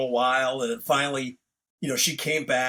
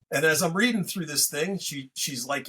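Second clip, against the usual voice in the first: ordinary talk, nothing marked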